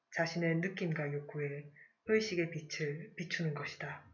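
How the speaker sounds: ordinary talk, nothing marked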